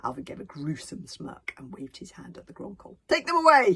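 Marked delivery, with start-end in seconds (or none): 3.1-3.7 high, loud command; ordering. A narrator's reading. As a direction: bark the order. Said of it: "he ordered".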